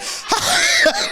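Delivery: shrieking laugh; high burst of laughter. Real, not acted. Cracking himself up, high shrieking laugh.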